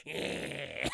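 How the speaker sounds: whispered monster yeah; creepy Benny rasp. Retold or imitated. Creepy Benny appearing, whispered creature voice. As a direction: hiss it like a Smeagol creature. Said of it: "pops up and goes".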